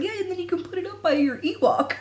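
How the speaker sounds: high, giggling through the line; laughing suggestion. Retold or imitated. Suggesting the Ewok spot to Michelle, laughing. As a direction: laugh as you pitch the idea. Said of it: "And I was like".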